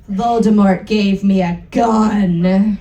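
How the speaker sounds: ordinary talk, nothing marked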